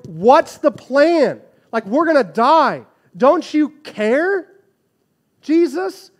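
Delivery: high, loud, slow frantic cry of men who think they'll die; panicked disciples. Retold or imitated. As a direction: yell it over the storm in terror. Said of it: "they're going".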